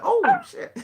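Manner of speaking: high, loud, laughing mock cry; laughing exclamation. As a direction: blurt it out through a laugh. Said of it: "He said".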